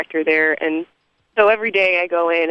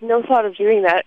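ordinary talk, nothing marked

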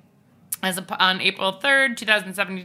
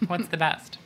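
ordinary talk

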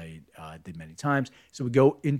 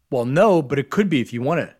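louder, higher mocking challenge; taunting teacher; 5 semitones higher, 9 dB louder than usual. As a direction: goad the kid with mock menace. Teacher taunting the disruptive student.

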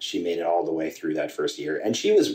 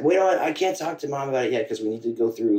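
much higher, hurried mock-panic; a flustered son backpedalling, voice pitched up; retold flustered panic; 9 semitones higher, 4 dB louder than usual. Son refusing stepdad about telling mom yet, high flustered mock-panic.

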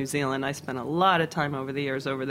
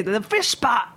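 higher, firm Kiwi repeat of the fish pot; boss repeats in Kiwi accent; 5 semitones higher, 4 dB louder, slower than usual. Retold or imitated.

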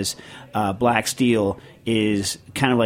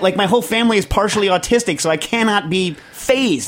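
excited; 8 semitones higher, 3 dB louder than usual. Real, not acted. Insisting he can't be fazed.

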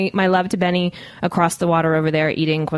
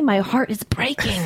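breathy whispered mock despair; a pretend broken heart, whispered and slow; mock heartbroken whisper; 4 semitones higher than usual. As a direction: whisper it like a wounded lover, hammed-up. Mock heartbreak over tweets.

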